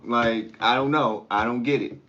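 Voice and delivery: usual speaking voice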